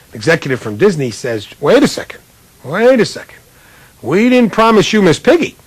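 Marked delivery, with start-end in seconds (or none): 1.6-5.6 sharply higher, hurried protest; alarmed objection. Retold or imitated. Disney executive halting the ABC producers, alarmed. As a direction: stop them right there, alarmed.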